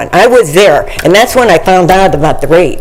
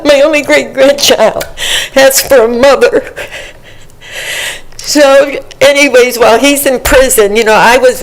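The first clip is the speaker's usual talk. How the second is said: upset, voice pitched high; 7 semitones higher than usual. Real, not acted. Grandmother distressed testifying about granddaughter.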